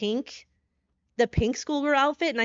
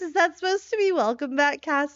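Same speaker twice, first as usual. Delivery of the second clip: ordinary talk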